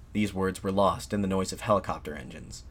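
usual speaking voice

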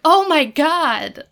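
loud, high, drawn-out re-enacted shock; shocked exclamation. Viewer reacting to the sudden escalation, shocked. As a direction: exclaim in exaggerated disbelief.

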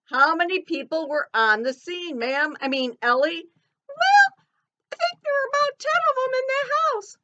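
high, squeaky cartoon elephant. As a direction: chirp it like a little elephant puppet. Puppet elephant Ellie answering the pastor.

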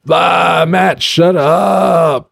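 high, drawn-out mock wail; exasperated listener. Fed-up listener begging the host to stop rambling, exasperated mock wail. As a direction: whine it way up high, stretching the words out.

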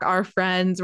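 plain reading voice

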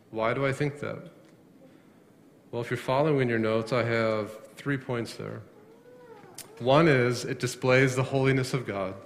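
ordinary talk, nothing marked

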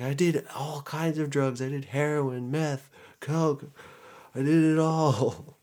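high, wailing imitation of confessing; mock tearful confession. Caught addict confessing every drug to police, mock wailing. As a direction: blurt it all out in a put-on cry. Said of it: "I'm just sobbing".

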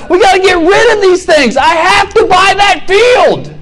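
high, loud frantic yell; frantic excitement. Acted. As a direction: sell it all in a frantic rush. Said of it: "He's like".

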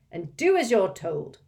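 loud firm order. Acted. Mr Weasley orders Ron, firm and loud. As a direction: close the matter firmly. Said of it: "said Mister Weasley firmly".

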